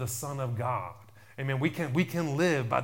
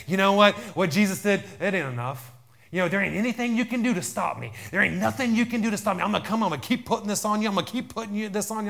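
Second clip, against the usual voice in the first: loud, raised, hammed-up threat; Satan's taunting voice; 8 semitones higher, 7 dB louder, faster than usual. Preacher voicing Satan threatening believers, loud taunt. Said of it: "Satan is saying there? He's saying".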